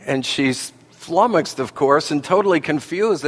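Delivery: usual speaking voice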